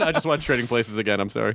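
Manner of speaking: ordinary talk, nothing marked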